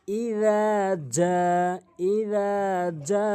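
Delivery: chanted ইদা জা with জা held long; drawn-out jim. Shown as a demonstration. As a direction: stretch the vowel of জা on an even note. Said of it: "আমরা টেনে পড়বো জিমকে".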